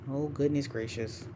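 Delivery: soft exasperated sigh of words; muttered exasperation. Real, not acted. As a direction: mutter it under your breath. Formula error, mutters to self.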